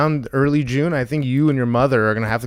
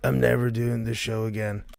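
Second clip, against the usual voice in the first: lower, softer deadpan character line; lightly voiced impression, low and quiet; Dawn; 4 semitones lower, 6 dB quieter than usual. Shown as a demonstration. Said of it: "what was that, Don?".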